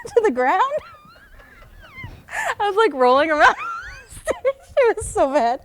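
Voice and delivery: high, loud laughing speech. Real, not acted. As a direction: laugh while telling it. Laughing recalling rolling on stage.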